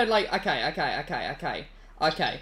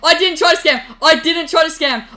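loud, higher, frantic denial; panicked protest; 6 semitones higher, 9 dB louder than usual. Real, not acted. Protesting to his brother that he never meant to scam, loud panic. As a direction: shout it out, desperate to be believed.

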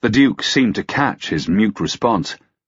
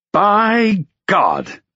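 high-pitched oath of outrage; indignant lord's exclamation; exclaiming; 14 semitones higher, 4 dB louder than usual. A narrator's reading. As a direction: burst out in disbelief. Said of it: "Lancaster exclaimed".

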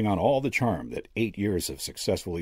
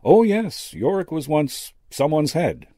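high, louder matter-of-fact reveal; amused old medium; 9 semitones higher, 5 dB louder, slower than usual. A narrator's reading. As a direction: confirm it cheerfully, pitched well up.

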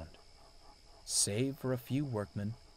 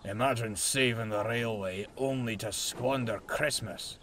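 mildly huffy grumble in a character voice. A narrator's reading. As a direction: huff it out, put out. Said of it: "huffed Duncan".

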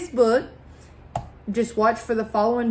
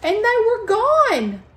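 high, louder burst of surprise; spring the reveal on the kids; sudden amazed reveal; surprised; 10 semitones higher, 5 dB louder than usual. Acted. Punchline of the birds vanishing.

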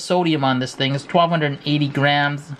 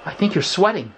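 normal talking voice

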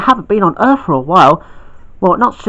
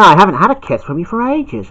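normal talking voice

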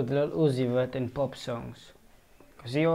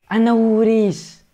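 loud, high burst of realization; excited recognition; 8 semitones higher, 7 dB louder than usual. Real, not acted. Reactor recognizes the flow in the voice, excited. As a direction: blurt it out, sure of the answer. Suddenly recognizes the featured artist's voice.

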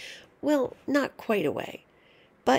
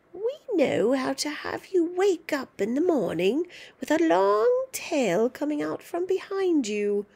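high, louder, cackling-edged threat; gloating witch grandma; 7 semitones higher, 3 dB louder than usual. Acted. Grandma taunting George about a tail, high gloating witch. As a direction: relish scaring the child.

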